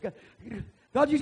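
mock stammer, high and loud; excited stuttering. Boy too upset to get the news out, stuttering loudly. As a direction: trip over the words in loud excitement. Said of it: "I was just stuttering and all upset".

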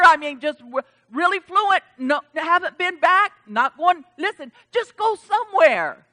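loud, high, urgent preacher's appeal; full-force preaching. Acted. Pastor pleading with members to return, loud and urgent. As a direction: drive the warning home to the pews.

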